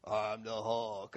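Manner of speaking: low, slow monster voice; a kid's comic superhero growl, drawn out; Hulk. Retold or imitated. Preacher imitating the little boy's Hulk voice. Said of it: "in this really hilarious Hulk voice".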